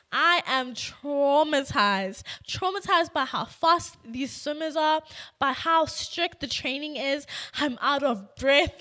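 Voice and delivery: hammed-up distress. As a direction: play up the mock trauma, punching words loudly. Retells traumatic swimming tryout in exaggerated present tense.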